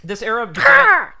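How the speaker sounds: high, loud quacky duck voice. Acted. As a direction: squawk it out like a duck. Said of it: "was that supposed to be a duck".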